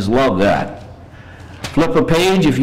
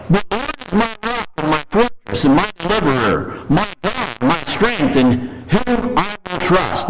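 scripture reading; 6 semitones higher than usual. Acted. Preacher reading the psalm to his congregation, reverent and higher.

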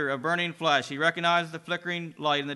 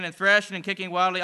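ordinary delivery, nothing marked

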